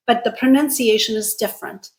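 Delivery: ordinary talk, nothing marked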